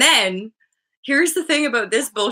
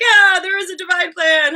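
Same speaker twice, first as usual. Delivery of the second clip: high, loud mock amazement; imitating people's sudden revelation; amazed realisation; 7 semitones higher, 6 dB louder than usual. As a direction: gasp it out like an astonished convert.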